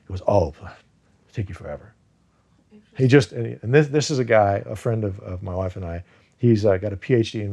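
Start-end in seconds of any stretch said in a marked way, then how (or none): 0.3-1.9 imitating a friend's offhand brush-off; casual dismissal. Friend answering how long learning Vietnamese takes, casually. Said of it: "just casually said something".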